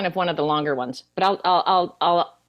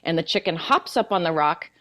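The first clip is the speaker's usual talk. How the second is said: normal talking voice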